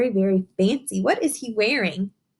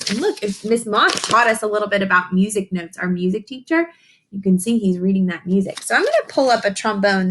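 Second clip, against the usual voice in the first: usual speaking voice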